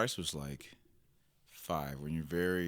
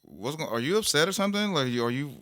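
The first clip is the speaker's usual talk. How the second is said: fast, high, loud worried questions; worried wife; 9 semitones higher, 10 dB louder, faster than usual. Retold or imitated. Wife asking her husband if he is upset, fast and high. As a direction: rush the concern out, pitched up and loud. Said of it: "She be like".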